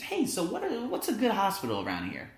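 high, light voice as himself asking students; high mock question. Asking his students for a hospital, high and light. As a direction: pitch up playfully asking for advice.

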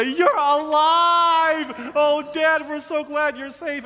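very high, slow, loud relieved shout; hammed-up boyish joy; overjoyed cry. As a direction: cry out like kids who thought their dad was dead. Boys run to found father.

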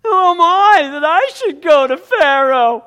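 high, loud whining plea; whiny Moses. Acted. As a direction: whine like a reluctant hero. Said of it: "he starts to whine".

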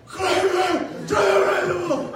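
loud high furious order; angry teacher yell. Retold or imitated. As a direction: shout the punishment. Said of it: "And she's like".

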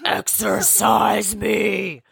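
slow, high shouted plea; demanding possessed caller. Pretend. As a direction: shout it like you need an exorcism now. Playing a demanding possessed person.

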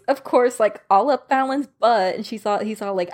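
ordinary talk

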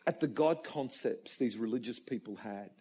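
ordinary talk, nothing marked

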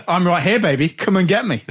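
loud, high-pitched mocking challenge; hammed-up challenge, pushed loud and high; mock wrestler promo. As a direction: parody a big footballer cutting a promo. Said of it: "he's cutting a promo on".

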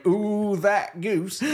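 Toby Jones impression. Toby Jones cursing the goose, mock dismayed groan. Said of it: "With Toby Jones going".

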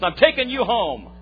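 slow and loud, laying down the law; firm announcement. Retold or imitated. Driver announces to his hitchhiker where they are headed, firm.

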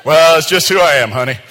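hammed-up unapologetic spouse; sheepish spouse. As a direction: laugh it off to your honey. Other spouse answers, laughing.

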